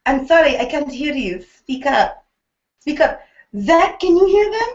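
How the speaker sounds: high-pitched mock TV host; Heidi Klum. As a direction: imitate a host who can't hear and demands an answer. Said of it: "this is what she says".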